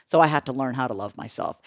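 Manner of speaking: soft, low, sincere; quiet sincere answer. Retold or imitated. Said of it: "I go".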